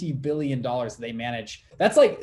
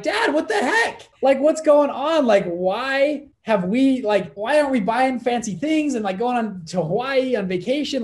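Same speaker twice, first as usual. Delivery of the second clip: much higher and louder, exasperated demands; incredulous outburst; 9 semitones higher, 5 dB louder than usual. Retold or imitated. Son confronting his dad about their frugal life, incredulous and loud. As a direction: demand answers in shrill-free disbelief, pitched way up.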